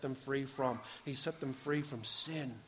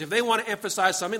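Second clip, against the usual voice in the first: usual speaking voice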